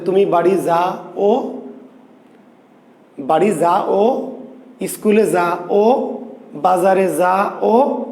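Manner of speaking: যা and ও said apart, with a break before ও; split vowels. Shown as a demonstration. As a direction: separate the vowels: "যা … ও", two syllables. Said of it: "তখন আমরা বলতে পারবো না যে".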